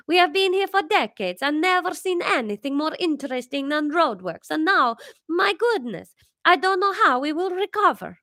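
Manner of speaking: high Italian mother amazed; Italian accent. Acted.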